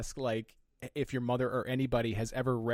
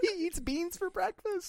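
mock outrage; slower than usual. Mock British shock line.

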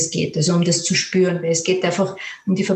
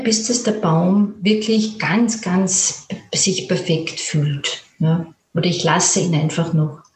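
calm, unhurried fantasy-journey voice; guided imagery. Acted. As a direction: lead the listener gently through an inner picture. Guest leads guided imagery journey.

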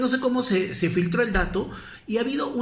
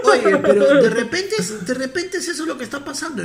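laughing; 6 semitones higher, 5 dB louder than usual. Real, not acted. Laughing at the Piura joke.

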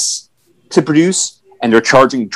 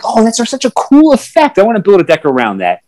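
excited fan; 6 semitones higher than usual. Retold or imitated. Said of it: "they see someone with Hull Breach and like".

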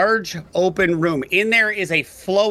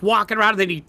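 ordinary talk

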